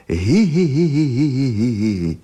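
dragonfly's high hee-hee giggle; high-pitched giggle. A narrator's reading. Dragonfly laughing at the butterfly's question, high giggling. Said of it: "na celé kolo se rozhyhotalo".

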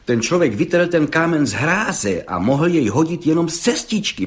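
ordinary delivery, nothing marked

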